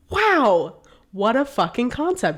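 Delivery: high, drawn-out wow; retold amazement. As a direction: act out being floored by a revelation.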